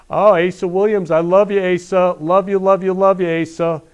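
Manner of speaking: loud, high, gushing call-out; excited greeting. Real, not acted. Host calls out to viewer Asa, loud and excited.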